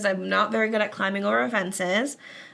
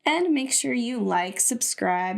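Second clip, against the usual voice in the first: normal talking voice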